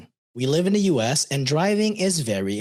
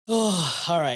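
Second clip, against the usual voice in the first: ordinary talk, nothing marked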